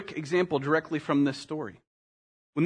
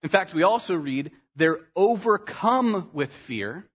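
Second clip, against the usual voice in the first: usual speaking voice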